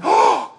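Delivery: big theatrical intake of breath; astonished gasp. Acted. They open the door and see Peter, astonished gasp. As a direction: gasp loudly in amazement. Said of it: "they were astonished".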